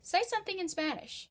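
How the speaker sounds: high-pitched imitation of a surprised uncle, loud at first; incredulous uncle. Uncle hears you write in Spanish, mock incredulous. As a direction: put on an uncle's high, disbelieving challenge. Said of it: "he's gonna look at you and say".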